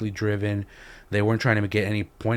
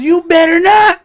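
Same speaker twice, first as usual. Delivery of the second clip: slow, screeching mock threat; imitated warning yell; 17 dB louder, slower than usual. Mother warning the man off, mock high slow yell. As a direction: shriek the warning high and drawn out.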